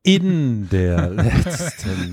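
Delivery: slow, drawn-out deliberate speech. Pretend. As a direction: stretch every word out slowly. (ganz langsam gedehnt)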